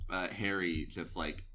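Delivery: ordinary talk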